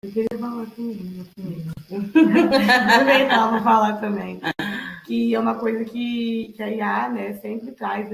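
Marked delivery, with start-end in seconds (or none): 1.8-2.9 loud burst of real laughter; a short, loud, hearty laugh; amused laugh. Real, not acted. Amused while asking to speak.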